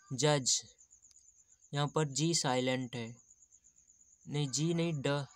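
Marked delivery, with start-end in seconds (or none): none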